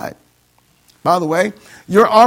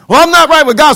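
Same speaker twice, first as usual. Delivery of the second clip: mock excuse voice; 7 semitones higher, 7 dB louder, faster than usual. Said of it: "You got so many people say".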